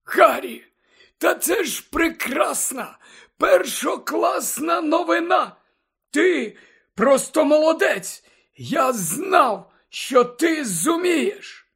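very high, drawn-out character voice; delighted headmaster. A narrator's reading. Dumbledore praising Harry for getting the memory, delighted.

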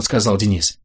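ordinary delivery

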